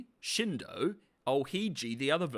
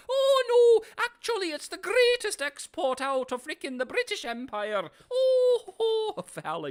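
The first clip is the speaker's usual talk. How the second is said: much higher, loud, squeaky mock of a boastful villain; mocking Fowler; 7 dB louder than usual. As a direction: squeal the empire's praise like a puffed-up fool. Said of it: "Fowler, my brother in Christ".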